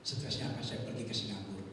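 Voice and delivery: ordinary delivery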